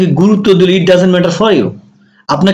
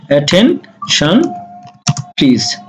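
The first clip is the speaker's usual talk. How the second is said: slow drill; 2 semitones lower, much slower than usual. Shown as a demonstration. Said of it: "তখন আমরা এটা বলতে পারি".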